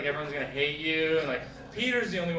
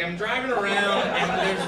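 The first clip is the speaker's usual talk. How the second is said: normal talking voice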